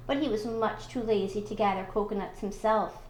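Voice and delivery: ordinary delivery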